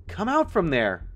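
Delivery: high, urgent call; yelled. A narrator's reading. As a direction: yell to someone out of sight. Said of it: "I yelled".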